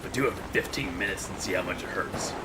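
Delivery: low, rough Solid Snake impression. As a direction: drop low and hushed like a grizzled soldier. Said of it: "their own Snake voice".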